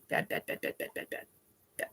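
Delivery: rapid-fire whispered warning; very soft, voiceless repeated aside; hushed repetition. Real, not acted. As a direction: whisper it over and over like a warding-off chant. Hushed repeated warning about the basement.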